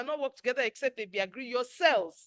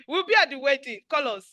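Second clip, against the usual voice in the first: ordinary talk, nothing marked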